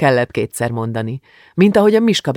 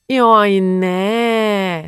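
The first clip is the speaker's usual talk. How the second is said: whining; 7 semitones higher than usual. A narrator's reading. Julcsi must stay home, whining protest. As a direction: wail it like a disappointed girl. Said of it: "nyafogott Julcsi".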